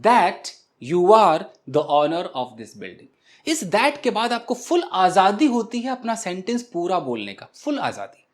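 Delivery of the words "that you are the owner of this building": slow demo answer. Shown as a demonstration. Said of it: "It seems".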